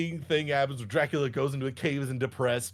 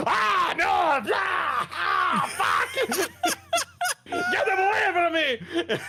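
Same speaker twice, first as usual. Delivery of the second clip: Dracula screaming; much slower than usual. Retold or imitated. Dracula shrieking as crosses surround him in church, hammed-up screams. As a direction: overact a vampire burned by crosses. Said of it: "Dracula's like".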